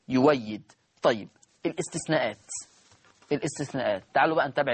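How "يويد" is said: low repeat of يويد with a w; waw replacing hamza. Shown as a demonstration. Teacher repeating the example, low, waw in يويد. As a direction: drop the pitch, glide the waw in يويد. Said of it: "هتبدل واو مفتوحة نحو كلمة".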